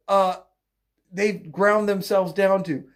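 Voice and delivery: ordinary delivery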